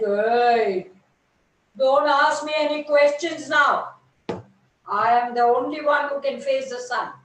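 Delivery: loud, haughty brush-off; proud refusal. Acted. Sunflower loudly turning the dewdrop away, haughty. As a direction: boss the little dewdrop away.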